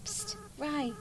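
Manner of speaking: high, soft whispered psst; urgent psst. Acted. Friend wakes sleeping child, urgent whisper. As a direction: hiss it softly to wake them.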